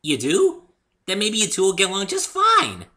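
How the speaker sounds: high, loud delighted pony; excited Pinkie; bouncy hopeful chirp. A narrator's reading. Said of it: "Pinkie speaking".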